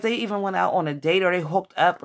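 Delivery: usual speaking voice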